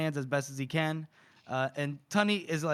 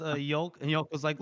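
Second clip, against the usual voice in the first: ordinary talk, nothing marked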